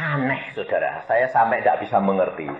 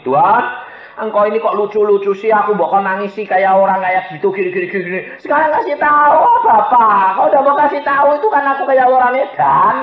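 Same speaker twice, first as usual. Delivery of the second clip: high, pleading whine to a father; childlike whining; 8 semitones higher, 4 dB louder than usual. Retold or imitated. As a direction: whine up at Dad like a pestering little kid. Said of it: "seperti anak kecil ngerengek sama bapak".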